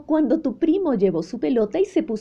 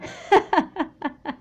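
high, helpless burst of laughter; tremendous guffaw; 8 semitones higher than usual. Acted. Mother can no longer control herself, tremendous guffaw. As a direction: let out a big pealing cackle of laughter. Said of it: "soltó una tremenda carcajada".

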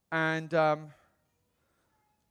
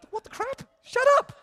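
shocked outburst; 6 dB louder than usual. Retold or imitated. Retold shocked outburst at friend.

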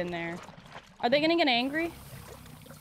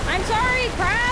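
high, loud cartoonish apology; mock apology to crab; 9 semitones higher, 7 dB louder than usual. Apologising to the angry crab, in a high loud mock voice. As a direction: squeak out a silly sorry at the crab.

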